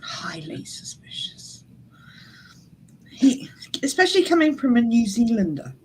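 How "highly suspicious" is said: breathy whispered echo; whispered mock suspicion. Real, not acted. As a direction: repeat it in a hushed whisper. Echoing in a whisper.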